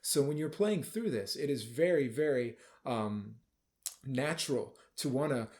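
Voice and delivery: ordinary delivery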